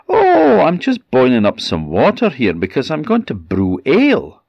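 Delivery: high-pitched, swooping, nervous cover-up; terrified mother. A narrator's reading. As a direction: start on a loud high "oh", feigning innocence. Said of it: "The poor woman was terrified".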